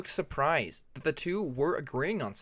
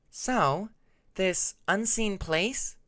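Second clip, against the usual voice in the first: higher, slow drawn-out doubt; skeptical question; 7 semitones higher, 3 dB louder, much slower than usual. A narrator's reading. Hyena questions Tempest skeptically, slow and higher. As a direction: raise a brow with your voice. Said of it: "raising her brow".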